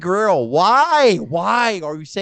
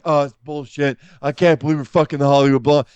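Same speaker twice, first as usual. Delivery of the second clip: louder griping in an imitation of Austin; mock angry rant; 5 dB louder than usual. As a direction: gripe it like a fed-up Austin. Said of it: "Austin's like".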